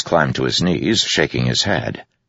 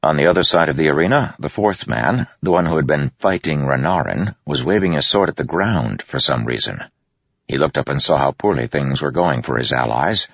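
ordinary delivery, nothing marked

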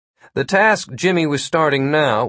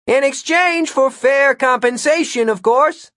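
high, loud grand call; bellowing; 13 semitones higher, 4 dB louder than usual. Acted. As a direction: keep the grand summoning bellow. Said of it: "Jimmy continued".

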